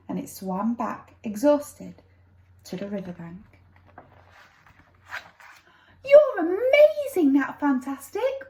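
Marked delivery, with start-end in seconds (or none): 6.0-8.5 put-on high, bright girls cheering; excited girls. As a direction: squeal it like delighted little girls. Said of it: "all the girls said".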